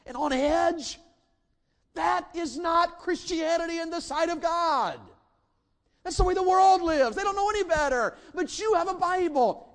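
high, loud, driving preacher's cry; full-force preaching. Real, not acted. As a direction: hammer the congregation with conviction.

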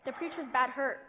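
loud, urgent call down the phone. Acted. Boy phones the operator for the doctor, loud and urgent. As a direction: shout for the doctor in a panic.

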